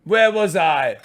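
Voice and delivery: theatrical posh English outburst; posh English accent. Pretend. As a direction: exclaim it in hammed-up posh English. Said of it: "I can't do the accent anymore".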